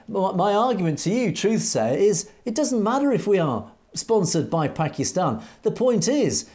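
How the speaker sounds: usual speaking voice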